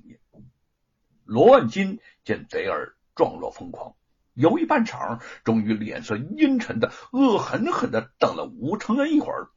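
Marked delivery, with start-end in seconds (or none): none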